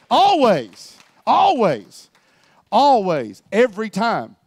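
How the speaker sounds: loud, punched, falling hits; emphatic declaration. Real, not acted. Preacher assuring the church of the Spirit's power, emphatic and loud. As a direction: proclaim each word with full conviction.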